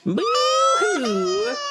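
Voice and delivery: mock boo-hoo. Player imitating a sulking crybaby with hammed-up boo-hoo wails. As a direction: wail the boo-hoos like a fake crybaby. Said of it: "He's like".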